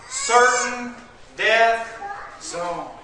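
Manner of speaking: loud slow preacher emphasis; preaching. Acted. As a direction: hammer it home slowly. Preaching at full force.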